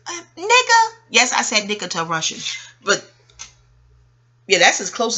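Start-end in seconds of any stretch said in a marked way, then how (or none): 0.1-0.9 very high, loud exasperated call; indignant. Retold or imitated. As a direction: drop it with sharp disbelief. Reacting to Russian being married.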